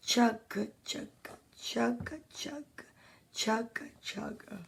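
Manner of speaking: chug quietly like an engine; half-whispered train puffs; train chugging imitation. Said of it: "He'd be like".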